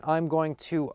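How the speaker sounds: usual speaking voice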